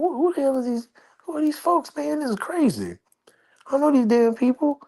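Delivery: who-are-these-people outrage, high and stunned; incredulous. Retold or imitated. Strangers run past for selfie.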